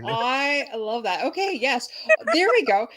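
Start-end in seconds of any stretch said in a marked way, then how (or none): 0.1-1.2 drawn out, slow, savoring each word. Real, not acted. Host repeats her delight to the guest, slowly. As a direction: stretch it out, relishing it.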